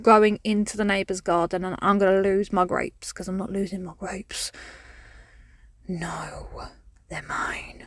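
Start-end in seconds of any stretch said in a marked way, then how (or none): 3.1-7.9 hushed, secretive whisper; possessive whisper. Pretend. Woman whispering that her grapes are hers, possessive hush. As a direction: whisper it like guarding treasure.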